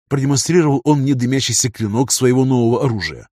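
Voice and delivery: plain reading voice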